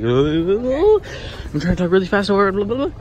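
real loud talk over blaring speakers; talking over music. Real, not acted. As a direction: raise the voice to drown out loud music. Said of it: "I'm trying to, like, over talk to it".

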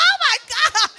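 hammed-up high scared squeal; girly shriek. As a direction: squeal like you just saw a mouse. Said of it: "I'm like".

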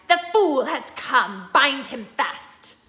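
loud, slow commanding cry; triumphant witch. A narrator's reading. Witch ordering her hags to bind Aslan, loud and slow. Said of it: "she cried".

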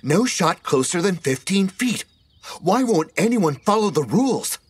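very high, exasperated yell; shouting. A narrator's reading. Deborah to rule-breaking players, high exasperated shouting. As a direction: scream at people breaking the rules. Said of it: "she shouted".

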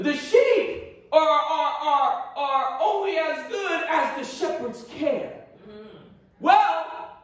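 ordinary talk, nothing marked

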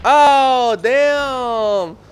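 loud, high "oh, damn"; startled loud exclamation; shocked outburst. Real, not acted. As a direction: blurt it out as the grenade goes off. Blowing himself up in game.

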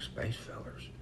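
whispered disbelief, breath only; stunned whisper. Retold or imitated. Stoner echoing his buddy in a whisper. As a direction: echo it back in a hushed whisper.

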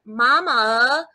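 "Mama" drawn out into extra syllables; "Mama" with a long, drawled, rising ending. Retold or imitated. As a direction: imitate a Southern child stretching "Mama". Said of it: "he has these extra syllables".

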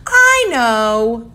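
put-on high, loud eager kid; know-it-all kid bursting with an idea; know-it-all exclaiming. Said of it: "exclaimed one know-it-all".

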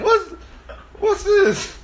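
high-pitched mock bafflement; squeaky double take; startled question. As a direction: react to a puzzling noise. Reacting to hearing sniffling.

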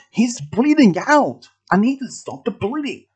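high, urgent protest; urgent plea. A narrator's reading. Milo pleading with gunman for wounded friend, urgent. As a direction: plead desperately, pitched high.

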